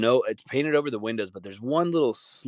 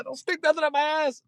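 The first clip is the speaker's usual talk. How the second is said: high squeaky frantic begging; panicked pleading. Retold or imitated. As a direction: plead with the aliens in terror. Blind guy pleading with aliens.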